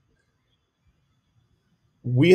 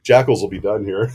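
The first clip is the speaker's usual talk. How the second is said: ordinary talk, nothing marked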